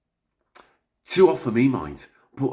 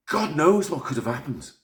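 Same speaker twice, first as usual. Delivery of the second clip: ordinary delivery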